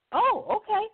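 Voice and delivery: high, bright oh okay; rising, taken aback; surprised. Retold or imitated. Woman learning the girl trains horses, surprised.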